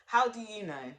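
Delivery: housing man disbelieving the caller, mock high incredulous voice; mock incredulous housing officer. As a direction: play the baffled man on the phone. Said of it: "the man on the other end of the phone to be like".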